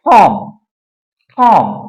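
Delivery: टॉम said as ठॉम; aspirated T. Shown as a demonstration. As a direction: puff of air opening टॉम. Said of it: "इसे क्या बोलते हैं".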